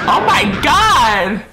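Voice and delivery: a real, slowed shout of shock. Real, not acted. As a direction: blurt it out loud, truly startled. Sudden shock while watching anime.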